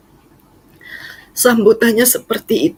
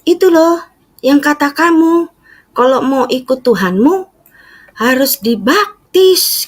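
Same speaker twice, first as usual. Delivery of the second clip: high, eager mother voice; mother explaining baptism, high and eager; mom explaining; 8 semitones higher than usual. Retold or imitated. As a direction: raise it to the mother's keen tone.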